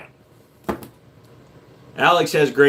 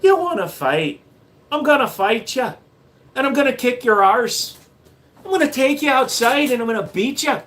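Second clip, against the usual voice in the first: high mock-Irish brawler; Irish accent; 6 semitones higher than usual. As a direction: pick a fight in a hammed-up Irish accent. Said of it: "My Irish and my Scottish go too close".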